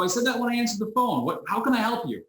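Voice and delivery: high, incredulous and exasperated. Retold or imitated. Owner fed up with caller, high exasperated. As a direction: roll your eyes at the caller.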